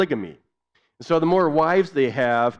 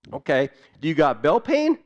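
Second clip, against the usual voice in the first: brisk question in Tok Pisin; lightly voiced doctor; doctor in Pidgin; 3 semitones higher, 3 dB louder than usual. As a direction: check the next symptom. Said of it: "share with you a little bit of what that was like".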